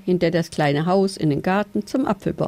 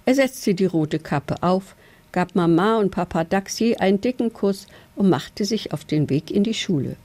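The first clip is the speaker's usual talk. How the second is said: ordinary delivery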